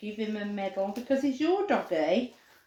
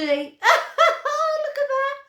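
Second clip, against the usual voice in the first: much higher, slow and giggly; laughing delight; 6 dB louder, much slower than usual. Real, not acted. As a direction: laugh it out while showing the dog. Laughing at heart doggy.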